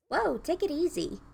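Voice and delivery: easy now, calming someone who's wobbling; character voice. Acted. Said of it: "Love the different voices you're doing".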